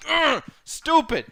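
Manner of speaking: high, slow groan of contempt; exasperated ugh; disgusted. Real, not acted. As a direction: drag out the disgust. Disgusted at WWE.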